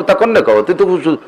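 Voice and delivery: ordinary talk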